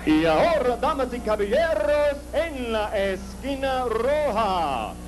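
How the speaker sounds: lucha libre announcer. Acted. Ring announcer opens in Spanish.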